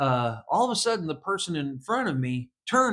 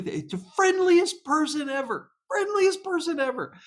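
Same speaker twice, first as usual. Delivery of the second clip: much higher, gushing praise; real delight, pitched way up; excited; 13 semitones higher than usual. Real, not acted. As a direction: rave about him, voice soaring.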